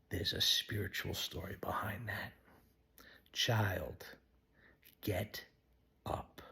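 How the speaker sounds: soft whispered telling; hushed preaching. Real, not acted. Preacher to listeners, whispered and tender. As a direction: hush it to a secret.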